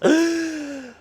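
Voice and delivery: breathless gasp for air. Real, not acted. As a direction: gulp air after long count. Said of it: "I'm out of breath".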